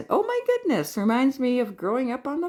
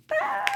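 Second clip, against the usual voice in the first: very high delighted squeal; short high squeal of joy; high-pitched happy shriek; excited squeal. Real, not acted. Squeals over the gift.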